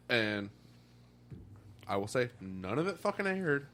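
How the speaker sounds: normal talking voice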